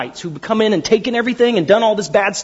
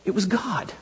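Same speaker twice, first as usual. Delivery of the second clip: hushed humility; 2 semitones lower, 10 dB quieter than usual. Retold or imitated. Acted humble credit to God.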